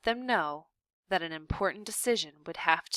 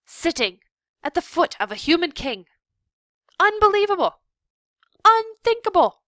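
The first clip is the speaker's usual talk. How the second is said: much higher, loud, fast outrage; outraged shout; 12 semitones higher, 12 dB louder than usual. A narrator's reading. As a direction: build to a furious shriek. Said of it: "he shouted".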